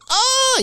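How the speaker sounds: mock startled shriek at the spotlight; imitating a blinded mom's yelp; mother's shriek. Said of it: "the one mother's just like".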